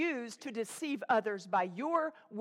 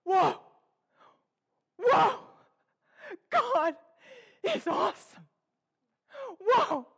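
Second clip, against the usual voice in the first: much higher, louder amazed whoa; childlike awe; 8 semitones higher, 5 dB louder than usual. Retold or imitated. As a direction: gasp it out like a wonder-struck child. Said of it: "just knows".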